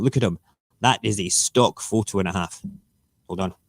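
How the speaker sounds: normal talking voice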